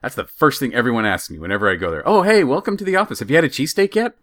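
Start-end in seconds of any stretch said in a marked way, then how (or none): 2.1-4.2 higher-pitched hearty coworker welcome; mock office greeting. Coworker welcomes a visitor to the office, mock hearty greeting.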